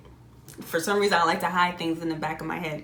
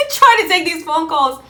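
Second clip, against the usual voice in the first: tearful laugh; 9 semitones higher, 8 dB louder than usual. Retold or imitated. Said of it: "I'm sitting here on the phone crying".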